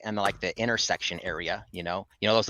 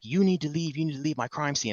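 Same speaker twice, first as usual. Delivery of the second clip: mild mock yell, pitched up; retold yelling at raised pitch, not full volume; imitated yelling; 6 semitones higher than usual. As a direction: imitate an officer ordering someone out. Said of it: "Why are you yelling at me".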